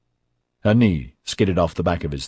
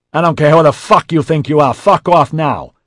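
loud, high furious yell; 9 semitones higher, 11 dB louder than usual. A narrator's reading. J C orders Melanie out, yelling. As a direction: yell it at full force. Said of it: "J C yelled".

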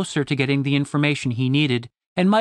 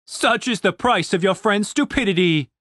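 lightly voiced gloating guard; a hint of a sneering soldier; pompous jeer; mocking soldier; 5 semitones higher than usual. A narrator's reading. Soldier mocks Dave.

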